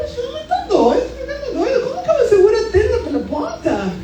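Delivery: high, laughing mock exasperation; exasperated disbelief. Wife retelling her husband's tent plan, laughing exasperation. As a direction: playfully voice your younger self thinking he's lost it.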